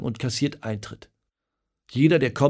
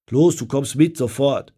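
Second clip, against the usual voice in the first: stern order; 3 semitones higher, 7 dB louder than usual. Acted. Father ordering son outside.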